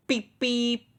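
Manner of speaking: a high, happy whistle beep; beep imitation. A narrator's reading. Thomas beeping as he puffs home, high cheerful beep. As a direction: chirp it like a tiny engine horn, high.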